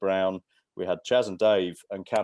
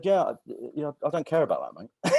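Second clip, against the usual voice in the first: higher, mock-offhand brush-off; lightly voiced "don't care" guard; dismissive guard; 8 semitones higher than usual. Jeff brushing off the comedian, mock dismissive and higher. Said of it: "And he said".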